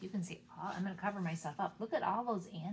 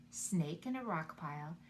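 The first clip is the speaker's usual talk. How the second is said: ordinary delivery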